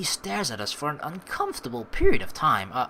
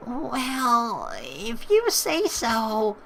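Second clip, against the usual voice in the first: put-on girl voice; 6 semitones higher, much slower than usual. Marina's reply.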